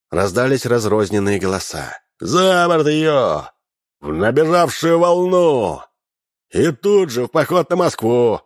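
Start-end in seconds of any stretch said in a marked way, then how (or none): 2.2-8.4 rowdy shout. A narrator's reading. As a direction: bellow it like a mob. Cossacks shout to drown her.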